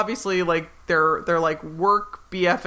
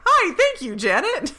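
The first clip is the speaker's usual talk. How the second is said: mock new person; 12 semitones higher than usual. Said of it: "And they're like".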